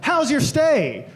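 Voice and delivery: nosy concierge barging in, slow and high; mock concierge. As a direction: drag out a cheery hotel greeting. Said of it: "the concierge to come barging in going".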